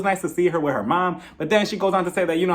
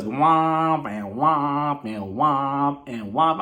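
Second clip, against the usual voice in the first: mock droning noise; 4 semitones lower, much slower than usual. Mocking Sonya's rambling as noise.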